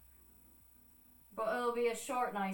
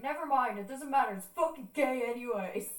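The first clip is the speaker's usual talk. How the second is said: louder, higher, retold outburst; imitated upset brush-off, pitch raised; upset dismissal; 4 semitones higher, 7 dB louder than usual. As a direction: give up loudly while upset. Retelling giving up while crying.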